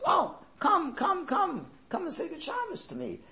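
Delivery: very high, eager calling out; beckoning rabbi. Retold or imitated. The rabbi beckons the shy porter, high and eager. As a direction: call him over with delight.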